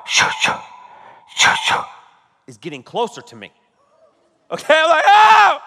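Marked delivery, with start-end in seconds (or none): 0.1-1.5 hissed double shoo; mimicked rhythmic creepy noise; imitated demon noise. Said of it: "the noise of the". 5.1-5.6 high, very loud comic scream; hammed-up burst of alarm; retold scream. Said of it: "I'm not screaming because".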